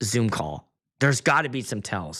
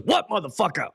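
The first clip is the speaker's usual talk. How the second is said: Sam Jackson; 5 semitones higher than usual. Retold or imitated.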